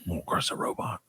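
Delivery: barely voiced, dropped retort; whispered retort. Real, not acted. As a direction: whisper it under your breath. Muttered retort.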